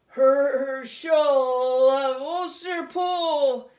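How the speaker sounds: very loud, slow, high monster roar; fearsome booming voice. A narrator's reading. Goblin king roaring Herschel's name from the doorway. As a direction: roar his name to shake the walls. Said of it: "A fearsome voice spoke".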